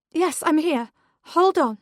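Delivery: high, loud urgent answer; mother calling through a locked door. A narrator's reading. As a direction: shout back to reassure.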